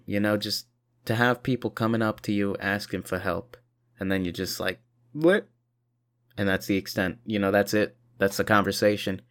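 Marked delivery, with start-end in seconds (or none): 5.1-5.5 mock confused what. Feigning not understanding.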